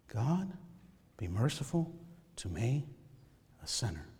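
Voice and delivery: hushed plea fading away; hushed prayer. Shown as a demonstration. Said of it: "You need a prayer to pray".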